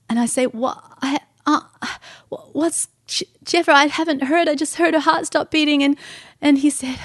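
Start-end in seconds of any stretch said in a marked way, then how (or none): 0.5-5.8 halting, higher-pitched disbelief; stunned stammering. Retold or imitated. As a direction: stumble over the words, thrown by shock. Stunned questions to the husband.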